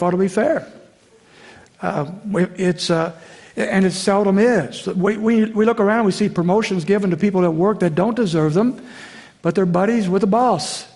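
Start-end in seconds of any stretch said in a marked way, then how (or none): none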